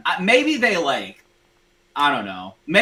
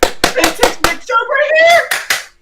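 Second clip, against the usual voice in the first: yelling in delight, very high and loud; excited shout; 13 dB louder, slower than usual. Real, not acted. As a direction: scream it at the TV. Celebrating the touchdown.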